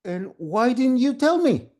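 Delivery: loud, high reproach; indignant demand. Acted. Mother confronting son who knew, indignant. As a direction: demand an explanation, scandalised.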